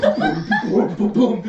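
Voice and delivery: usual speaking voice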